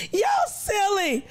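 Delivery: laughing. Real, not acted. Still laughing at chat.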